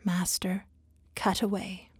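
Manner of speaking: soft, low whisper. Acted. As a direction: barely breathe the consent. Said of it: "whispering low".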